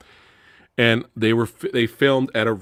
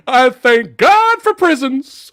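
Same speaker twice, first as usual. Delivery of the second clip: high, slow, loud mock scream; hammed-up shout; 8 dB louder, slower than usual. [name] reacting to the killer's answer, hammed-up high shout. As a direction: cry it out in comic horror.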